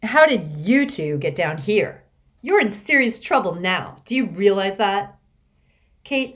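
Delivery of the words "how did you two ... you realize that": haughty. Acted. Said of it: "she quickly grew haughty".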